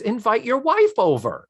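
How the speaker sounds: high, bright-eyed insistence; eager invitation. Retold or imitated. Hostess to the married clerk, high and eagerly inviting.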